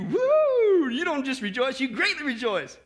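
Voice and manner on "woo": high, loud burst of joy; exultant whoop. Real, not acted. Exults on the word rejoice.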